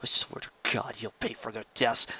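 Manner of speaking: hissed whispered threat; whispered vow of vengeance; seething whisper. Acted. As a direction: vow it low through rage. Sheridan vowing revenge.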